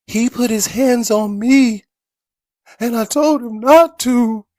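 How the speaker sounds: high, slow, tearful whine; crying young man impression. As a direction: imitate a sobbing teen complaining. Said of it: "That's actually a pretty good impression".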